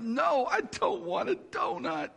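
put-on girl begging not to take the donut, tearful; sobbing plea. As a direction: beg through tears like a crying girl. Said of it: "she starts kind of sobbing out".

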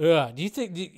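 ordinary talk, nothing marked

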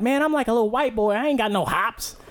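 put-on kid's voice. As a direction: play the little kid shrugging it off. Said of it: "he's like".

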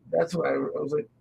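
ordinary delivery

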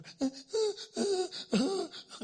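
loud, high mock sobbing; imitated child's cry. Preacher shows little kids who got hurt, mock crying. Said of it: "crying that deep, deep hurt cry".

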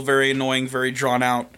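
normal talking voice

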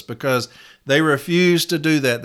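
normal talking voice

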